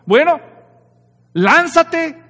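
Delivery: devil's dare. Retold or imitated. The devil daring Jesus to throw himself down, loud and high. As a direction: dare him to jump, loudly and high. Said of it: "Entonces el diablo dice".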